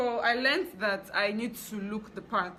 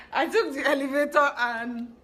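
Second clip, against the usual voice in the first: laughing through the words, louder; 6 semitones higher, 7 dB louder than usual. Real, not acted. Telling viewers about the elevator, laughing. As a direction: giggle out the line.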